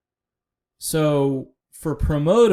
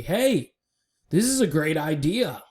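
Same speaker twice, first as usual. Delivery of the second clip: higher-pitched, mock-excited announcement; mock enthusiasm; 3 semitones higher than usual. Vlogger mimicking a turnaround to everybody, mock enthusiasm. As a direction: pitch it up like a hyped-up proposal. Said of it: "and said to everybody".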